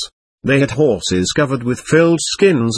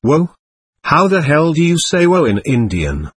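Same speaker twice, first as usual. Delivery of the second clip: understated narrated yell, a touch raised; lightly raised calling-out, as in a story; mild retold yell; 4 semitones higher than usual. A narrator's reading. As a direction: retell a friend's yell without really shouting. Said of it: "Then I heard Lee yelling".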